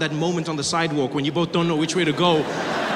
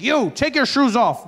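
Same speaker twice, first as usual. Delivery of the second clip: loud, higher, slower command; airport officer's order; 5 semitones higher, 5 dB louder, slower than usual. Retold or imitated. Security officer to foreigner, loud brusque order. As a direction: bark it at a clueless traveller. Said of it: "but they don't care".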